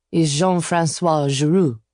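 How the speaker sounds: put-on slow, lightly French-accented man; a hint of a French caller introducing himself. A narrator's reading. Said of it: "in a familiar French accent".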